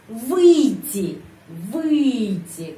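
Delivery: "выйти" with a long, loud first syllable, then falling; word stress demo. Shown as a demonstration. Teacher shows the stress for learners, drawn-out "выйти". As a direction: stretch and punch the start of "выйти". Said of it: "penekanan di sini".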